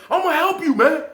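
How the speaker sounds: high, loud offer of help; insisting. Retold or imitated. Officer insisting on helping the inmate, loud and high. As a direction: push the offer on him loudly. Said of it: "You say".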